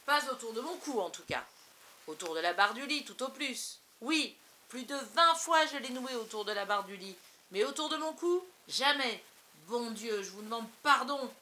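sarcasme crié, plus fort; râler avec une ironie mordante et bruyante; sarcastique. Acted. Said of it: "Le ton devenait dangereusement sarcastique". (un père qui se moque de lui-même, fort et sarcastique)

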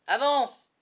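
loud, high command to move; barked order. Acted. Soldier orders the captive boy to keep walking, loud. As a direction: shove him forward with the word.

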